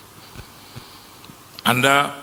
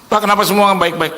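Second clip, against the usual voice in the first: exasperated; 10 semitones higher, 5 dB louder than usual. Retold or imitated. Questioner exasperated.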